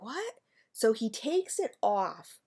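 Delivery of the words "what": surprised what. Retold or imitated. Shocked reaction to the discovery.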